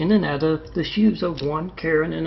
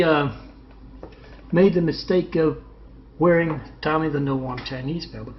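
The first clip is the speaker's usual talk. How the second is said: ordinary talk, nothing marked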